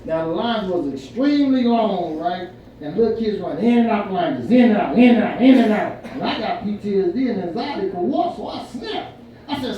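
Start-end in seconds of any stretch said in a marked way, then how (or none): 4.5-6.0 louder, hammering each in; rhythmic emphasis. Retold or imitated. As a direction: beat out the chaos word by word. Mimicking kids running chaotically.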